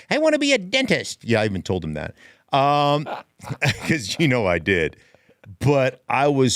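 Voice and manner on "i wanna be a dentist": high, put-on elf voice; Herbie impression. Host mimicking the GM as Herbie, high put-on elf voice. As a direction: squeak it out like the Rudolph elf. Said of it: "looks like Herbie from Rudolph".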